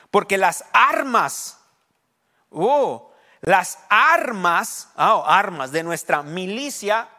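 loud, high, very drawn-out key words; forceful scripture reading. Acted. Preacher reading the verse to the congregation, loud emphatic proclamation.

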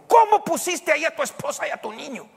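very high, loud indignant question; shocked reproach. Retold or imitated. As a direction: scold him in disbelief. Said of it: "Y me dijo". (mamá Belén, indignada, aguda y fuerte)

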